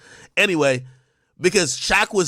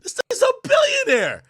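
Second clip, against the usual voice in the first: much higher, half-shouted panicked thought; mock panic. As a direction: squeal it out like a sudden shock. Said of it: "you start thinking, like".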